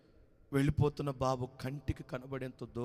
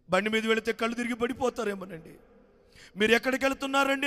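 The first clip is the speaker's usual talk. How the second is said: worried wife; 9 semitones higher, 8 dB louder, faster than usual. A narrator's reading. Worried wife questions husband.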